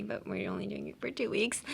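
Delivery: whisper. Real, not acted. Aside, whispered.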